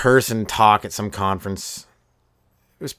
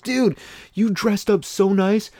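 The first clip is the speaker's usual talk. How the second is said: high, drawn-out incredulous teasing; amused teasing; 11 semitones higher, slower than usual. Retold or imitated. Buddy Mike teases his dressed-up friend, amused disbelief. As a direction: stretch it out in mock amazement.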